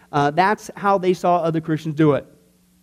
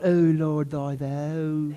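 slow, lower mock King James prayer; mock pious prayer; 5 semitones lower, much slower than usual. Pastor mocking stiff archaic prayer, slow and low. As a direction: parody an old-fashioned prayer voice. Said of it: "is that the only way that we can speak to God?".

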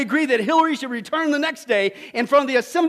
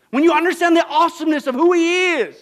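louder, higher peak; sermon climax; 5 semitones higher, 8 dB louder than usual. Acted. Pastor proclaiming God's awesomeness loudly. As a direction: lift it to the climax.

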